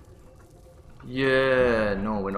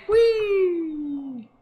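much higher gleeful mock squeal; mock whee. Player jumping off the edge with a playful whee. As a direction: squeal it like a kid on a slide.

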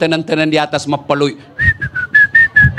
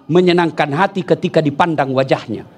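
ordinary talk

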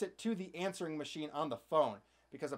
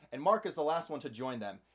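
ordinary delivery

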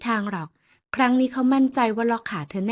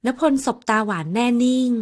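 plain reading voice

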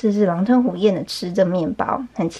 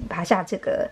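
ordinary delivery, nothing marked

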